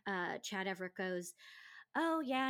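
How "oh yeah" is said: eager blowhard. Retold or imitated. Retold actor's eager reply.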